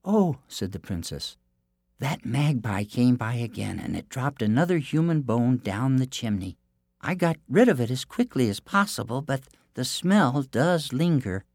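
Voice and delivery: high, sweet innocent princess; theme park princess. Acted. Princess deceives giant.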